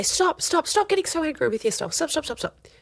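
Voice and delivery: put-on calm the child with repeated stops; firm soothing parent. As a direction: parent gently but firmly stopping a child's self-anger. Parent calming frustrated child.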